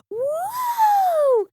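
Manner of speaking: high whooping "Woo!"; crowd woo. Retold or imitated. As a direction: cheer "Woo!" like a rock star. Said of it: "getting them to just yell".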